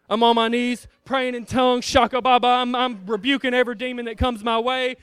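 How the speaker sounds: ordinary talk, nothing marked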